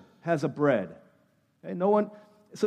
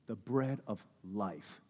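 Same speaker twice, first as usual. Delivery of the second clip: slow hushed echo; 11 semitones lower, 15 dB quieter, slower than usual. Acted. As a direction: repeat The bread of life slowly, very soft and low.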